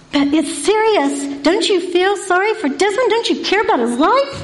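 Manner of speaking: mock pleading, voice rising; pleading teen. Boys pleading urgently.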